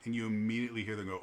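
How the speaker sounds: ordinary delivery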